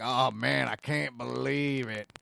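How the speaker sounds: low, slow mock Southern drawl; Southern accent. Host imitates believers of the fraud claim, Southern accent. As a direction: play a gullible listener with a Southern accent. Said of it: "I'm doing a Southern accent".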